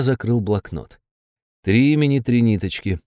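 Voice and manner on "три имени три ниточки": ordinary delivery, nothing marked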